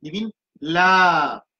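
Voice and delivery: "la" drawn out slowly; teacher modelling fatha with alif; long vowel demo. Shown as a demonstration. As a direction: hold the "la" long to show the long vowel. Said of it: "Longue voyelle, c'est fatha avec alif".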